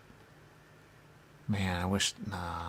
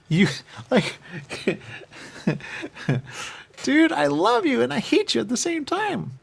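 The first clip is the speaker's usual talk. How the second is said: laughing; 6 dB louder than usual. Retold or imitated. Laughing disbelief at the guy.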